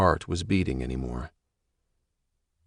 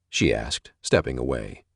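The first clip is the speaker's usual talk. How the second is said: ordinary delivery, nothing marked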